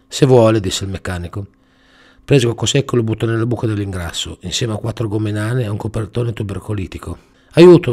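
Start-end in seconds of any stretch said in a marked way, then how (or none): 0.1-0.6 indifferent mechanic. Acted. Mechanic shrugs. 7.6-7.9 high, loud desperate cry; cry for help. A narrator's reading. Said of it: "urlò Coco Secco".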